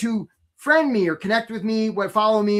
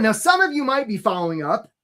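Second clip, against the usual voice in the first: normal talking voice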